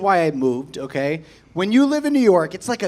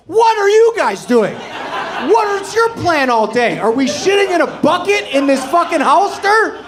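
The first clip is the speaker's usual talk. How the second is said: higher, louder frantic shout; yelling at the Hollister staff, frantic; desperate rant; 7 semitones higher, 4 dB louder than usual. Acted. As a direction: bellow it in desperation.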